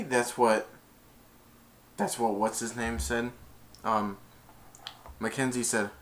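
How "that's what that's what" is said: normal talking voice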